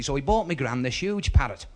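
ordinary talk, nothing marked

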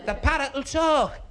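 high, laboriously slow calling; shouting for deaf gran. Acted. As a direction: stretch each word out for failing ears. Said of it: "my gran was a bit Mutt and Jeff".

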